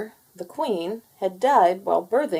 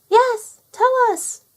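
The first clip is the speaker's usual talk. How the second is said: high, loud squeaky chick begging; a little chick pleading for the story; eager chick voice; 7 dB louder, slower than usual. Acted. Chick begs the nursemaid to go on, high and eager.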